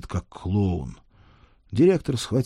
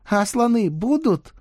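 put-on high, slow eager kid; child voice; 4 dB louder than usual. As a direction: ask like a hopeful little boy. Boy character asks.

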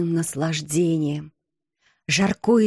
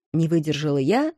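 ordinary delivery

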